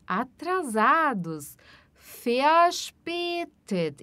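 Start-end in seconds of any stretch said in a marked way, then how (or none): 2.2-4.0 Verspätet with the V said as F, very slow; German V as F. Shown as a demonstration. Teacher to students, slow model of Verspätet. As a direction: say Verspätet with an F sound at the start. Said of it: "o V no alemão sempre vai ter som de F".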